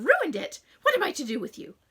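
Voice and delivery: loud, high wailing tirade; fussy mother scolding her daughter; melodramatic mother. A narrator's reading. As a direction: rant like it's a catastrophe.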